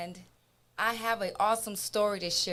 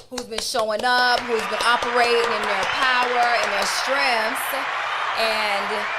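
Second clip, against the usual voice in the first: louder, raised cheer; celebratory shout-out; 3 semitones higher, 10 dB louder than usual. Real, not acted. Host celebrating men who show up, loud cheering tribute.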